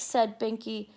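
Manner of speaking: ordinary delivery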